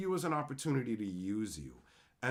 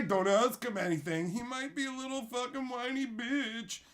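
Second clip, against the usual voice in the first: higher mock taunt; mocking himself as a whiny crybaby; 9 semitones higher than usual. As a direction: sneer it in a put-on voice. Mocking voice continues.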